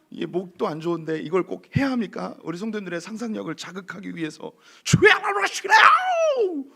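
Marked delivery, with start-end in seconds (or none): 4.8-6.6 high, drawn-out full-force yell; imitated crowd shout. Preacher imitating the crowd to spark the congregation's imagination, yelling. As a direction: cry out slowly at the top of your voice. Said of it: "이렇게 막 소리를 질렀을 거예요".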